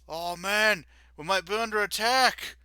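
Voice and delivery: high, loud nervous guard; worried guard. Acted. Guard fearing an attack to his fellow. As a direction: fret that the attack is real.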